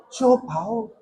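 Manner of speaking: ordinary delivery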